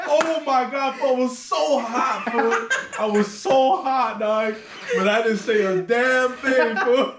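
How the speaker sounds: high, loud laughing-through-words. Real, not acted. Storyteller laughing hard telling friend about his anger. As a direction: laugh while reliving the embarrassment.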